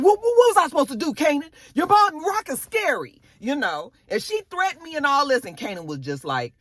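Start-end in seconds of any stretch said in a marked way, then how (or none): 0.0-5.4 high, fast, panicky pleading; defensive friend. Retold or imitated. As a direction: voice Fame squeaking out excuses. Fame defends himself to Kanan.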